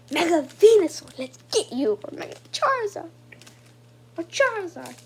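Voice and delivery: loud, excited calling of names. Real, not acted. Collector calling for the cards they want, excited. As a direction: punch out each wished-for name, high then falling.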